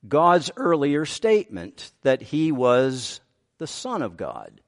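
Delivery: ordinary talk, nothing marked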